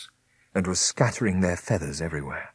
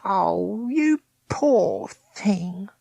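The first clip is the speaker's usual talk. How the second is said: higher, slow, drawn-out pity; pitying Meg; 10 semitones higher, much slower than usual. A narrator's reading. Meg pities condemned Smith, high and slow. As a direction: stretch it out, higher, full of pity.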